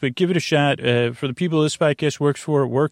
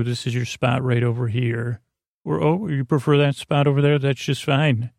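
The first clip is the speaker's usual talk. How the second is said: usual speaking voice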